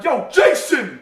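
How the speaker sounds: loud call across the casino floor; yelling. Retold or imitated. Drunk man being thrown out yells to his friend, a loud call. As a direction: shout to a friend at another table. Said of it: "he was just yelling".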